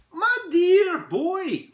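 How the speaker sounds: very slow, high, loud condescension; haughty elf putting a boy down; cool reply. Acted. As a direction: stretch out a lofty "my dear boy". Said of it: "Poindexter replied coolly".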